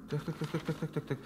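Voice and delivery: soft repeated muttering while reading; quiet mumbling as he skims; muttering to himself. Acted. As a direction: murmur it under your breath. King mutters reading the letter.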